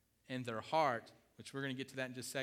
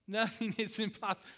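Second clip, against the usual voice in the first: laughing emphasis; 7 semitones higher, 6 dB louder than usual. Real, not acted.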